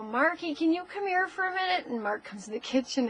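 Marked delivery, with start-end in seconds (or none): 0.0-1.9 retold calling out. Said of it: "So I go".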